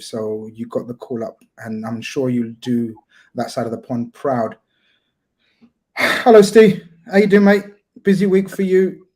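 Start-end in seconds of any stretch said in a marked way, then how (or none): 6.0-9.1 hello called out high and loud; host loudly hails the guest joining the show; big loud greeting. Real, not acted. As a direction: hail your guest loudly and brightly.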